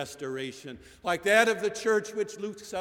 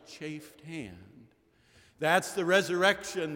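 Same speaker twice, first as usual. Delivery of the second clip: normal talking voice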